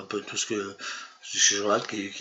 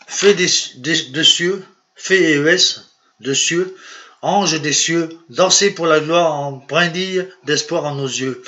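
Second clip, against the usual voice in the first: projected, lifted declamation of verse; poem recitation; 5 semitones higher, 6 dB louder than usual. Acted. Poet reading his own verse to viewers, declaimed with projection. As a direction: declaim it phrase by phrase like a lyrical poem.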